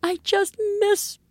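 mock a sad kid missing her friend; mother imitating her tearful daughter; crying child.

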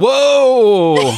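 high, loud, drawn-out cry of astonishment; shocked exclamation. Real, not acted. Listener reacting to the burned baby story, loudly shocked. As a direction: burst out in amused shock.

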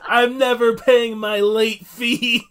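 loud, slow mock-heroic vow; Nightwing. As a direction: proclaim it with hammed-up defiance. Nightwing defying the video store.

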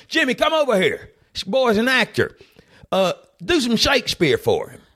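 old southern man hollering to Jimmy, hammed-up; calling out to Jimmy. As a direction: shout over to the young guy.

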